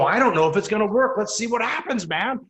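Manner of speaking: fired up to just try it; excited. Retold or imitated. New agent telling the team, eager excited rush. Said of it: "You're excited about things".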